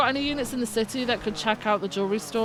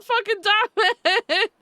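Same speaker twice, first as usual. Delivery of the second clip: laughing; 10 semitones higher, 4 dB louder than usual. Real, not acted. Cracking up at the prank.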